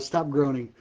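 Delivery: ordinary delivery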